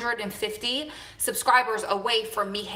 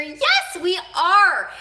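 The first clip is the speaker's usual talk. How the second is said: high, loud burst of excitement; excited; 10 semitones higher, 9 dB louder, much slower than usual. Real, not acted. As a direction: shout yes with delight. Excited answer to viewer question.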